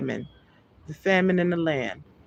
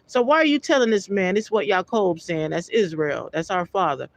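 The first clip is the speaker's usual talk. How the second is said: normal talking voice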